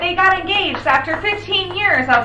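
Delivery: high, excited gushing; mock gushing. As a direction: gush like a thrilled fan. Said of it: "standing up in a cafeteria like".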